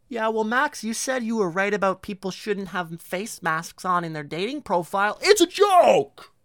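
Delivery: high, loud mocking voice cutting in; mock heckler. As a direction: heckle himself in a shrill put-on voice, peaking at the end. Self-mocking imagined heckler.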